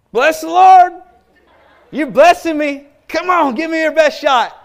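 high, very loud triumphant shout; exultant challenge. Retold or imitated. New convert welcoming next day's mockery, exultant challenge. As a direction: holler it, daring the mockers on. Retold defiant joy at being mocked.

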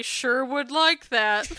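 put-on slow, drawn-out sly little girl; hint at wanting it, sweetly; coy angling child. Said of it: "she would say".

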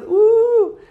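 long, high, loud ooh; delighted "ooh". Acted. Teacher relishing smelling good for Shabbat, delighted ooh.

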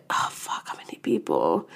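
real whisper to oneself; hushed, voiceless thinking aloud; whispered aside. Real, not acted. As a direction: drop to a whisper while trying to remember. Muttered aside while recalling a number.

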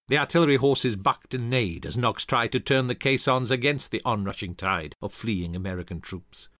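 plain reading voice

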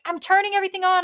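high-pitched retold protest; exasperated retort. Worker to impatient customer, high exasperated retort. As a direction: answer back, exasperated and high.

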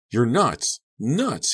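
high, loud angry outburst; exasperated shout; scream. A narrator's reading. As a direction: yell it, pitch climbing on the repeat. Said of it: "he screamed".

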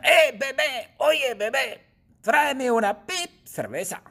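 high, loud bossy yell; shouting. Acted. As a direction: bark an order at the baby. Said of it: "Susana le grita". (Susana le grita a la bebé, grito mandón y agudo)

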